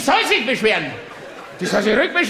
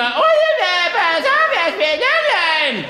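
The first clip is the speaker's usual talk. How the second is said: much higher, screeching mock mother's cry; shrill tennis mother; 8 semitones higher than usual. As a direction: shriek her son's name like a frantic tennis mom. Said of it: "Und dann schreit die immer". (hohe, kreischende Tennismutter, parodiert)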